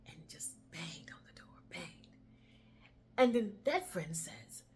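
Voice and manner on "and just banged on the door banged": whispered exhaustion. A narrator's reading. Exhausted banging on door.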